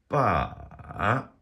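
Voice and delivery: BA drawn out, down then back up; dipping tone. Shown as a demonstration. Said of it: "goes down and then it comes back up".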